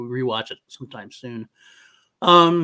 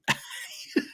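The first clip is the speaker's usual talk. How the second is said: high squeaky laugh; 6 dB quieter than usual. Real, not acted. Laughing at C word joke.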